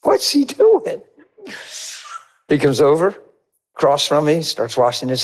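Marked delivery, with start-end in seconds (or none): 0.0-1.0 very high, slow puzzled wonder; drawn-out high bewilderment. Retold or imitated. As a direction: stretch it out high and baffled.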